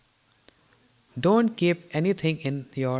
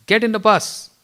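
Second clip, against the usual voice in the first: stern order; 5 semitones higher, 5 dB louder than usual. Retold or imitated. Elder scolds delaying brahmachari.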